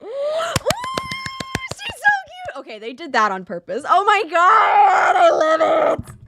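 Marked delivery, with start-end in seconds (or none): 0.0-2.6 delighted squeal. Real, not acted. Gushing over cute character. 3.9-6.1 excited shout. Real, not acted. Thrilled by game scene.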